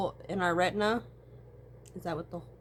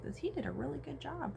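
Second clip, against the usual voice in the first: ordinary talk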